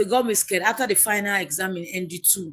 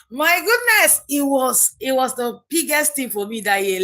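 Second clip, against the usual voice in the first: laughs, then bursts out higher and louder; joyful laughing exclamation; 5 semitones higher, 5 dB louder than usual. Real, not acted. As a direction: relive the joy of the good news, laughing. Recalls passing with upper grade.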